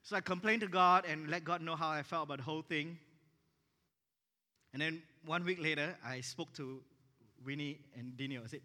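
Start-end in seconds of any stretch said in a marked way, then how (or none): none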